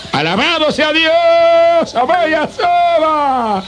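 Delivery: high, slow, drawn-out exultant cry; ecstatic praise. Real, not acted. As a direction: lift the praise up high and stretch it. (alabanza extática, aguda y alargada)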